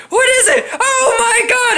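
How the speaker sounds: high shriek through laughter; imitated horrified scream. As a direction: replay the victim's cry while cracking up. Replays overheard scream, laughing.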